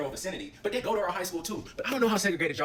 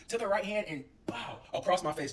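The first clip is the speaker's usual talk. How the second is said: usual speaking voice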